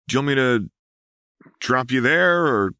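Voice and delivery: light friendly guy voice; lightly voiced Chuck. A narrator's reading. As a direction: pilot calling back from the controls.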